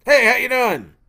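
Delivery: loud, high mocking echo of a greeting. Hunter copies a friend's greeting, loud exaggerated mimicry. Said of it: "a little bit louder, a little bit more emphatic".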